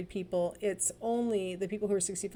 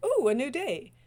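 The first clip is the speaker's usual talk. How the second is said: excited dog; 6 semitones higher, 6 dB louder than usual. Retold or imitated. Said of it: "be like a dog every day".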